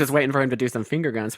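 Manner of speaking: usual speaking voice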